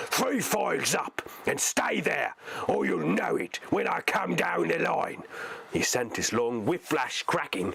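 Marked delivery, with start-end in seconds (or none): 0.1-5.2 higher orc voice with menace; threatening orc. Acted. Orc driver orders three files up, high menacing orc. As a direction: promise the whip.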